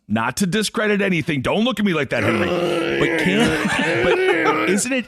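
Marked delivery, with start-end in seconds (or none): 3.0-5.0 laughing. Real, not acted. Laughing high start.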